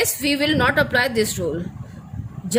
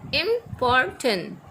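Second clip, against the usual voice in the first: important stretched slowly, im-por-tant, each syllable apart; slowly drawn out by syllable; much slower than usual. Shown as a demonstration. Teacher showing students the syllables, slow and drawn out. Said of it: "they are consisted of three syllable".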